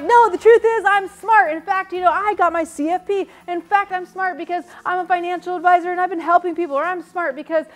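high, loud, building to wild cheering; over-the-top seminar hype, escalating; pumped-up affirmation. Acted. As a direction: psych yourself up into a frenzy.

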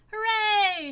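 high, very loud cheer; a crowd's joyful shout; shouted hooray. A narrator's reading. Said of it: "shouted the other animals".

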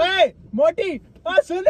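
high-pitched call after someone. Real, not acted. Man calling back his departing friend, calling out. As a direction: holler to stop her leaving.

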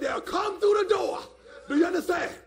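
loud, insistent preaching; emphatic shout. Real, not acted. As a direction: shout it so the whole church gets it. Repeats it as a shout.

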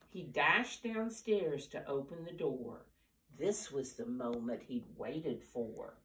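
plain reading voice

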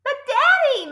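put-on high, pleading child; upset girl's high plea; crying out. A narrator's reading. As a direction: protest to Daddy in a high whine. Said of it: "Matilda cri".

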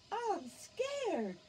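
high, small frightened voice; high little voice of a nervous cat; scared cat. Acted. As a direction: squeak it like a scared kitten. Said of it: "said Scaredy Cat".

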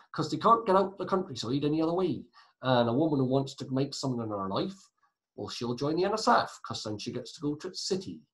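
badly faked West Country accent. Teacher explaining why countryside women join, in a mock West Country accent. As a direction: ham up a rural West Country voice. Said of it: "Sorry for the West Country accent, it was atrocious".

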